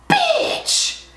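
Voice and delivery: squeaked, appalled exclamation, way up high; very high shocked squeal. Real, not acted. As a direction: squeal it in outrage at the price. Repeats it, outraged at price.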